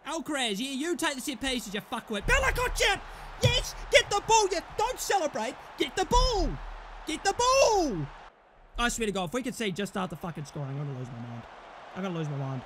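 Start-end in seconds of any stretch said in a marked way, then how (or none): 2.3-8.1 fired-up raised holler; urgent goal shout. Real, not acted. Goal, urging quick restart. 8.8-12.6 low, fast grumble of frustration; dropped voice, barely contained exasperation; seething muttering. Real, not acted. Seething after scoring frustration.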